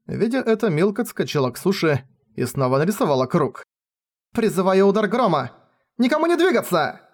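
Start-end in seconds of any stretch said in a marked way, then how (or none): 4.3-7.0 high shouted spell; shouted command. Acted. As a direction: shout them down. Girl casts spell, threatens.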